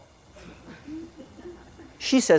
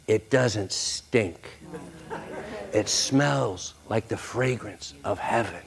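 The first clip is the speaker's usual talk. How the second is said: reverent hush; 4 semitones lower, 6 dB quieter, slower than usual. Real, not acted. Reverent image of heaven.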